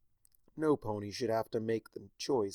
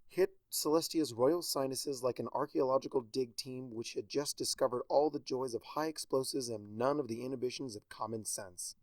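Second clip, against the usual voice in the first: plain reading voice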